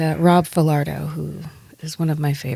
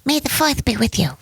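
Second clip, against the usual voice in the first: Mike Tyson impression; 8 semitones higher than usual. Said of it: "trying to sound like Mike Tyson".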